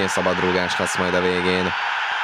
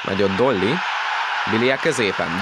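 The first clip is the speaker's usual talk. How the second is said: normal talking voice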